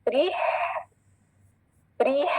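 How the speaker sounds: री closed with an audible h of breath; thin R, long ee, airy ending on री. Shown as a demonstration. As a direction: model री slowly, exhaling after the ee. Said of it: "इसी तरह जैसे मैं पढ़ रही हूँ".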